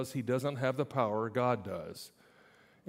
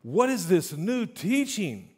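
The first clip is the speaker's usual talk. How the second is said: slow, raised, wondering question; amazed; 5 semitones higher, slower than usual. Acted. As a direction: ask it in astonishment. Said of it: "they asked each other".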